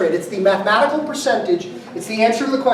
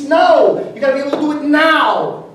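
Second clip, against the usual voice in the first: insistent client; 4 semitones higher, 4 dB louder than usual. Retold or imitated.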